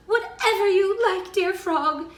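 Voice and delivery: high, loud princess voice; eager princess. A narrator's reading. Narrator voices pleading princess.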